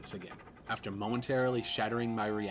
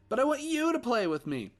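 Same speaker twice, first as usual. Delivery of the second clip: put-on high, loud whining little boy; whiny child; 8 dB louder than usual. Ghost son whining at daddy, high. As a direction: pout like a child.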